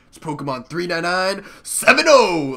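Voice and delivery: imitating a friend introducing himself with gusto; enthusiastic intro. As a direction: belt out the channel name like a proud host. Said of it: "say the numbers with so much enthusiasm".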